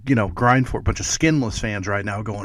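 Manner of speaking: ordinary talk, nothing marked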